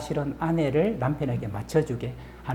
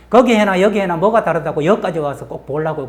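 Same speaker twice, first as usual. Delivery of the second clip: loud, raised-pitch mock grumbling; retold husband's outburst; 4 semitones higher, 9 dB louder than usual. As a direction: imitate a fed-up husband dropping a bomb. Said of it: "남편이 거기다가 폭탄 하나 더 터뜨렸죠".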